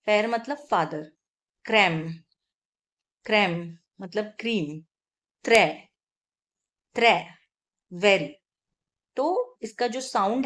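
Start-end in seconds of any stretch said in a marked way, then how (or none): 1.7-2.1 क्रैम with an open è, like the French word crème; French è demo. Shown as a demonstration. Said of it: "sound change होता है". 5.4-7.3 त्रै with an open è, like the French word très; French è demo. Shown as a demonstration. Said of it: "sound change होता है".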